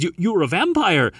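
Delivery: high, stammering astonishment; taken aback, pitch jumping up; surprised. A narrator's reading. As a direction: blurt it in high-voiced shock. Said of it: "He's surprised".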